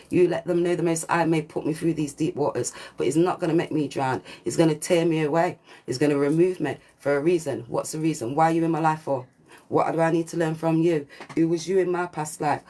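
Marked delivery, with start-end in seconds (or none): none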